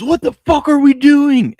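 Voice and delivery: high, loud, drawn-out mock yell; imitating furious fan. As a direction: act out a fan losing it over the game. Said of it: "Cole was losing it".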